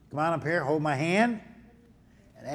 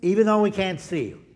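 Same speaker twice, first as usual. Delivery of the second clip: put-on slow, louder prayer lines for kids to repeat; echo prayer; 6 semitones higher, 7 dB louder than usual. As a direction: pray it out slowly so the children can echo each phrase. Leads children in echo prayer.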